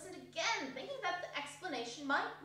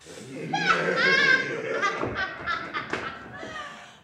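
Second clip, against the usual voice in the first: much higher, much louder burst of cackling; loud cackling laugh; 12 semitones higher, 12 dB louder than usual. Acted. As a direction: let a wild gleeful cackle rip. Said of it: "they all three burst into a laugh".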